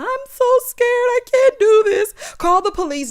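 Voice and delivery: high, loud, panicky mock crying plea; imitating a scared cry. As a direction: play her frightened wail, hammed-up. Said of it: "So Monique crying".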